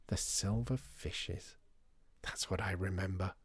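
very soft, slow whisper; awed whisper. Acted. Customer holding remembered sardine tin, whispering in wonder. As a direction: whisper it, lost in the memory.

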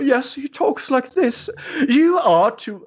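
high, slow, sneering bureaucrat voice; mock a stuffy UN envoy with disdain; snarky diplomat. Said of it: "stereotyp som pratar".

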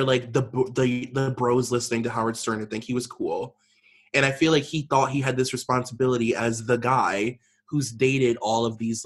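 ordinary talk, nothing marked

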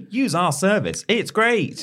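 hammed-up advertising voice; mock ad voice. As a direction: parody a cheesy sales line. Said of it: "what they have on their homepage saying".

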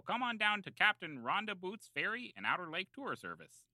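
normal talking voice

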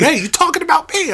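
high, quick, put-on challenge; mock touchy friend. Host imitating a friend who thinks he is criticised, mock affront.

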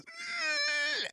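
high-pitched squealed whimper; mock bawling. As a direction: squeal like a man starting to bawl. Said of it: "he starts bawling".

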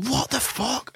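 high, hushed mock astonishment; retold disbelief. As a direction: squeak out a stunned reaction softly. Retold reaction to winning numbers.

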